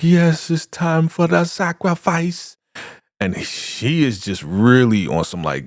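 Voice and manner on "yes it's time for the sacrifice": high, slow zealot's cry; Elder Mother. Retold or imitated. Old cult follower cheering on the sacrifice, high drawn-out voice. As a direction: draw it out high, like a cult chant. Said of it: "And Elder Mother's like".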